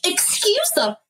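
high-pitched, offended outburst; indignant. Real, not acted. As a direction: voice shoots up in affronted disbelief. Host's indignant reaction to quoted insult.

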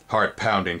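plain reading voice

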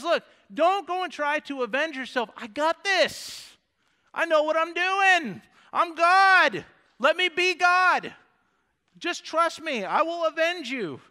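high, loud voice of God taking charge; emphatic God. Acted. Voicing God claiming vengeance as his.

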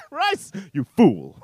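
high mocking cackle, then a slow, loud jeer; mocking scorn. Comedian jeering at a guy who took rice, mocking scorn. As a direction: laugh derisively, then draw out the insult. Acts out mocking a diner's choice.

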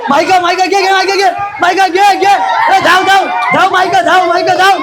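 very high, urgent calls to a player; excited race-side yelling; frantic cheering on. Real, not acted. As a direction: urge the runner on, voice pitched right up.

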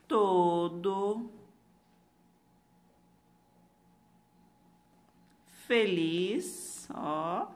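slow word-by-word dictation; dictating. Shown as a demonstration. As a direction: spell it out slowly for the class. Teacher dictating sentence word by word.

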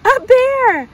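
high, loud surprised cry; surprised echo. Real, not acted. Answering the child's guess, surprised. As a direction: react with delighted surprise.

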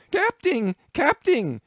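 high, loud panicked yokel yell. A narrator's reading. Bumpkin running to the captain, high panicked yell. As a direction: shriek it like a terrified country boy. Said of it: "yelled the bumpkin".

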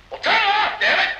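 irritated outburst. Acted. Boss yelling at staff to stop the tape, exasperated. Boss snaps, recording still running.

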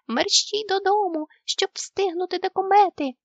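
high, loud hurry-up call; urgent shout. A narrator's reading. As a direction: shout to hurry everyone home. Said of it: "крикнув Нюхмумрик". (тривожний вигук)

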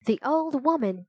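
plain reading voice

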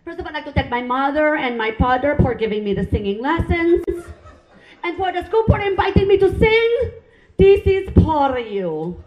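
hammed-up Filipino accent singer dedicating the song. Filipino student thanking parents before singing, in a Filipino accent. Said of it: "They have that accent".

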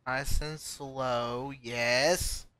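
slow, soft self-coaching; soft coaxing. Real, not acted. Gamer coaxing a tricky climb, slow and soft. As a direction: ease it along gently.

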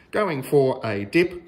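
ordinary delivery

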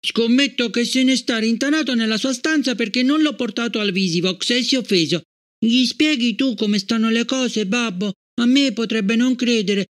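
put-on a small girl coaxing her father; little girl's voice. A narrator's reading. Gloria asking her father to explain, childlike coaxing.

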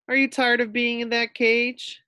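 high, bright little-sister voice; girl's voice. A narrator's reading. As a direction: ask sweetly like a young girl. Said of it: "she asked Fluffy".

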